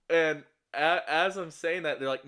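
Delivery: normal talking voice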